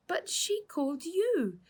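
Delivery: put-on high, slow, outraged girl's protest; child pleading with the police; indignant protest. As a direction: stretch it out in disbelief.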